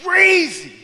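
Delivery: high, loud cry of disbelief; imitated outburst. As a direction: yell it out, overwhelmed. Man's outburst before he cries.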